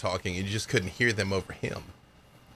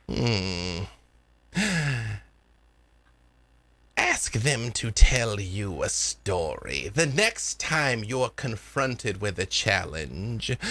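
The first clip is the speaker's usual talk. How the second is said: theatrical elder with swooping pitch and drawn-out phrase endings; mysterious spirit granny. Acted. Granny teaching the girl about the spirits, theatrical and knowing. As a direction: relish the key words like arcane lore. Game master plays Granny advising.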